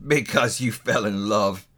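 slow, sour miser's growl; grumbling, drawn-out disbelief; growled scorn. A narrator's reading. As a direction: echo it with heavy contempt. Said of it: "growled Scrooge".

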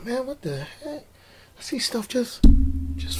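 drifting off, puzzled, under breath; trailing off. Real, not acted. Confused by stuff on screen.

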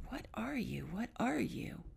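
hushed, breathy questioning whisper; an awed, half-voiced whisper; whispered inner thought. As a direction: whisper it as if thinking to yourself. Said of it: "and I'm thinking".

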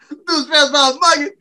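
ordinary delivery

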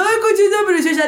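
put-on silly baby talk. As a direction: coo at the dog like a silly owner. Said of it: "voz de tarado".

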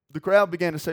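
ordinary talk

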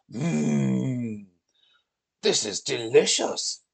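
savouring Viking hum, then praise; delighted Viking. Acted. Viking tasting carbonara, delighted. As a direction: relish it after a long hum.

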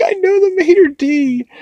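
very high, loud cartoon girl voice; imitating a thrilled cartoon date; excited antelope character. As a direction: squeal it in mock delight. Retold antelope boasting at restaurant.

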